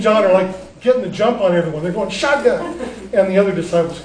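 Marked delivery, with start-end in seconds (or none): 2.1-2.8 mock shotgun call. Disciples grabbing the best seat.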